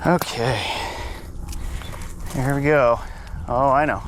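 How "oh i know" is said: normal talking voice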